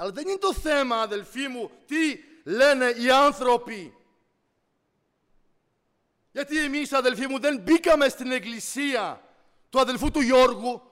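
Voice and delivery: loud, forceful sermon delivery; impassioned preaching. Real, not acted. Preacher telling brothers whose church they came to, impassioned. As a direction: drive each phrase out to the whole church.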